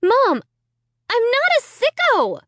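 high, furious shriek. A narrator's reading. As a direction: shriek the denial. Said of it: "I shrieked".

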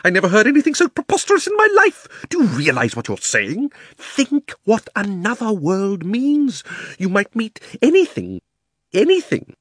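high, loud, affronted uncle; outraged disbelief. A narrator's reading. Uncle refuses to risk himself, outraged and aghast. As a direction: splutter in high-pitched outrage, dragging key words.

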